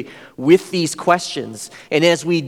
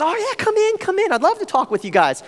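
very high, excited welcome; friendly host. Retold or imitated. Host eagerly invites the students in, very high and excited. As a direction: sing out the invitation inside. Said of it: "The guy's".

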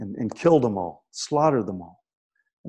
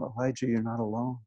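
soft, lowered comforting voice; gentle reassurance; 4 semitones lower, 10 dB quieter than usual. Retold or imitated. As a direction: reassure him quietly. God reassures Elijah quietly.

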